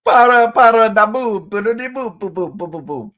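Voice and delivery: high, loud scat-like babble, easing off; playful nonsense. Pretend. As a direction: riff on silly syllables up high. Playful mock-Italian nonsense riff.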